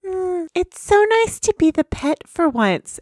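Fluttershy voice. Acted. Voicing Fluttershy.

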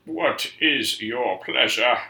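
slow masked villain voice; Bane impression. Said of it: "That's my bad Bane impression".

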